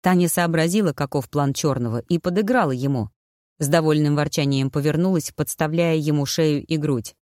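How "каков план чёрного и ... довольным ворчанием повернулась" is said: usual speaking voice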